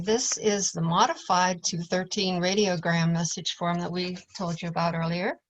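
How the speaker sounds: ordinary delivery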